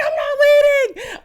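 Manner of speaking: very high, loud, drawn-out yell; defiant scream. Retold or imitated. Mother refusing nurse while pushing, defiant scream. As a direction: scream the refusal slowly in acted fury.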